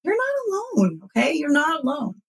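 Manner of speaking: reassuring. Retold or imitated. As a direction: comfort a survivor gently. Reassuring fellow survivors.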